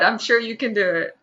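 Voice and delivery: ordinary delivery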